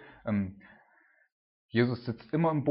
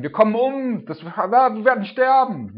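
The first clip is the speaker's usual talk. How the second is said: screamed much higher and louder in terror; panicked scream; 11 semitones higher, 13 dB louder than usual. Retold or imitated. Disciples screaming in the storm, terrified high scream. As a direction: shriek it like the boat is sinking. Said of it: "fangen an zu schreien".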